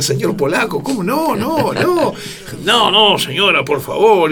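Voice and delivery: high-pitched imitated courteous insisting; mock polite protest. Guest and host's mother over mate, mock polite protest. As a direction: pile up polite no-no's, voice climbing high.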